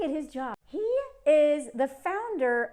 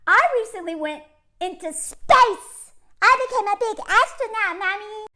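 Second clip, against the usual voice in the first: put-on childish billionaire parody; 7 semitones higher, 6 dB louder than usual.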